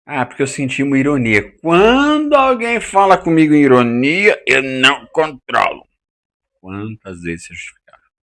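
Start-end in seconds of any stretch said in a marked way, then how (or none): 0.1-5.9 defensive excuse. Shown as a demonstration. Teacher voices aggressive person's excuse.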